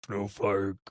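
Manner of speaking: deep voice calling. Retold or imitated. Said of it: "he hears a deep voice call out".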